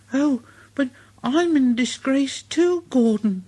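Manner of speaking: high little engine voice; Thomas eager. Acted. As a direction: chirp back in sympathy. Thomas replies.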